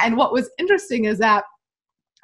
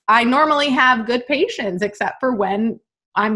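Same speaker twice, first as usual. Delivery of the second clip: ordinary talk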